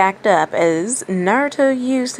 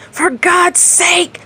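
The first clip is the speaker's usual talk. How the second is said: frantic scream, much higher and louder, slow; 12 semitones higher, 5 dB louder, much slower than usual. A narrator's reading. The demon loses patience with Gaara, frantic scream. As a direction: scream in utter exasperation. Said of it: "screamed the voice".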